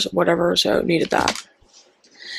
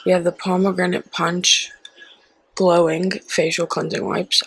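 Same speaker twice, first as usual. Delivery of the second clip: whisper. Acted. Whispering product names.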